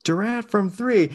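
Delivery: slow, high excited play-by-play; Ian Eagle call. Pretend. Imitating Ian Eagle calling a Durant three, slow and high. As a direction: stretch it out like a big basketball call. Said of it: "Ian Eagle impression".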